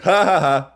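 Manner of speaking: spelled-out mocking laughter. Friends texting back after the accidental love you, mocking. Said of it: "they're like".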